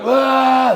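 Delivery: high, loud startled cry of alarm; a sudden shrill shriek of panic; panicked yelp. Retold or imitated. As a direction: yelp it like someone freaking out. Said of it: "like, freaking out".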